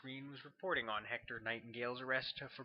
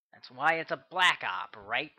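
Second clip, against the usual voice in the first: pointed challenge; 10 dB louder than usual. A narrator's reading. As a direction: push back at him, a little loud. Maynard pushes back at colonel.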